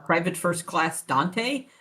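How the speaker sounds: ordinary delivery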